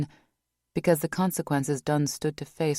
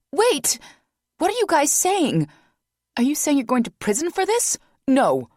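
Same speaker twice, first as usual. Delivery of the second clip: higher and louder, frantic questions; a woman desperate to stop this; panicked; 12 semitones higher, 7 dB louder than usual. Acted. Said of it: "panic in her voice".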